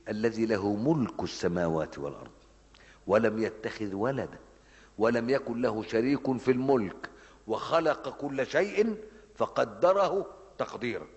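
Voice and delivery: slow, solemn recited verse; Quran recitation. Acted. Preacher reciting the Furqan verse to his listeners, slow and solemn. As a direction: intone the verse slowly with reverence.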